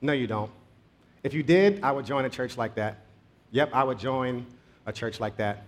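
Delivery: low, soft retold reply with a smirk; understated smirk, voice dropped and quiet; slight smirk. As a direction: quietly play a smirking doubter. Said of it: "Harry broke out with a little smirk".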